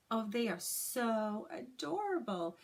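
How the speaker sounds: ordinary delivery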